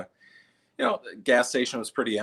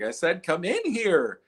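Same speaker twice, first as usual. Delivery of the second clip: mock loudspeaker; 10 semitones higher than usual. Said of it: "going over the loudspeaker".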